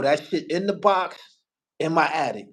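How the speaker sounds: ordinary talk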